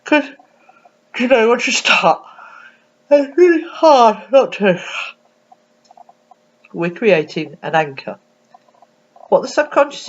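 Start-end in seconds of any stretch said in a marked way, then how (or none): 0.0-4.8 high, slow words stretched by a real yawn; yawning helplessly through the line. Real, not acted. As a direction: caught mid-yawn while talking. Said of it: "and yawn yourself".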